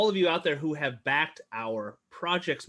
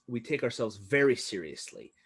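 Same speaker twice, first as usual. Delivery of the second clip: ordinary talk, nothing marked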